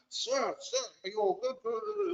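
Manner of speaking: high, soft mock-concerned boy voice; imitating teen boys. Boys crowding the teary teacher, high soft mock concern. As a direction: coo it like a worried schoolboy.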